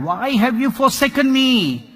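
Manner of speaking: high, loud repeated why; anguished cry. A narrator's reading. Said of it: "So he cried".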